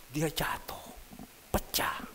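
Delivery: whispered and hushed; dramatic whisper. Acted. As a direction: let it land in a whisper. Dramatic retelling of injury.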